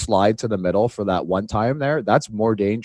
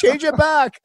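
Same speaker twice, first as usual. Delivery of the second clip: high mock voice; 4 dB louder, slower than usual. Players answering coach, retold.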